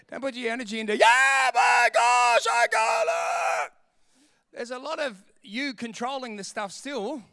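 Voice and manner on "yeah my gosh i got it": very loud, drawn-out hammed-up yell; mock screaming. Preacher parodies putting energy into screaming, a mock yell. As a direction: holler it long, wasting all your energy. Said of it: "kill all the screaming".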